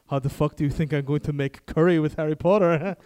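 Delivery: bursting out at the wrong shopping; exasperated outburst. Retold or imitated. French flatmate yelling about curry, mock exasperated outburst.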